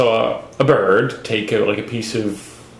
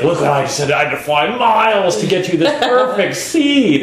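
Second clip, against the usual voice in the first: higher, hammed-up show of hardship; boastful father bird; 6 semitones higher than usual. Father bird showing off seed to the nest, mock heroic. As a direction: oversell the long flight. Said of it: "on their forehead and says".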